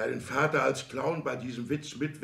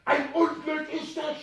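much higher, slow wail; anguished outcry; 5 dB louder than usual. Acted. Father wailing at son's news, slow anguished cry. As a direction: drag it out as a disaster.

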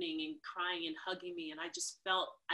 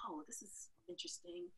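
wry aside; 11 semitones higher, 13 dB quieter than usual. Retold or imitated. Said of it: "I was like".

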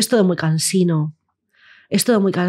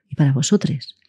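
ordinary talk, nothing marked

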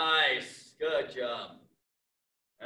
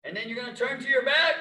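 ordinary talk

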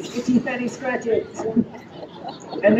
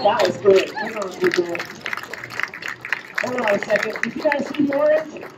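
ordinary delivery

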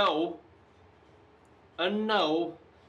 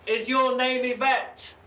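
loud, high, slow question; calling to spirits; 9 semitones higher, 5 dB louder, slower than usual. Real, not acted. Investigator asks a spirit its name, calling loudly. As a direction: call it out into the darkness, drawn out.

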